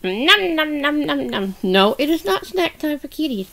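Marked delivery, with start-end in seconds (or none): 0.0-1.6 gobble like a hungry puppet; pretend munching. Grogu pretends to eat cat.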